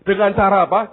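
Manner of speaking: ordinary talk, nothing marked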